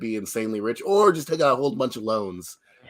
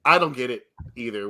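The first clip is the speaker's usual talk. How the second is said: usual speaking voice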